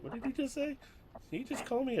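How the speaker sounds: high-pitched mock-confused reaction; retold bafflement. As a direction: act out not catching what was said. Acting out confusion at fast talk.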